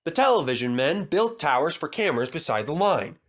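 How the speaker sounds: ordinary delivery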